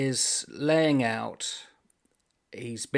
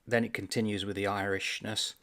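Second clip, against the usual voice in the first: ordinary talk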